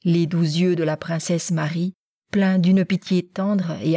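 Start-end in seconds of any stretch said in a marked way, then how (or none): none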